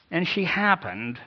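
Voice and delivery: HAPPENED pitched high and drawn out; knowing inflection. Shown as a demonstration. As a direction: lift and stretch "happened" knowingly. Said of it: "with an inflection in my voice".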